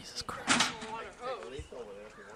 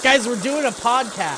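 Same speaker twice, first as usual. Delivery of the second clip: calling out; 6 semitones higher than usual. Real, not acted. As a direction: call it out across the room. Calling to Doug across room.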